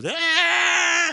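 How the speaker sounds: loud, very high scream; mimed scream. Retold or imitated. Parasailer to boat crew out of earshot, screaming. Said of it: "how much I screamed up there".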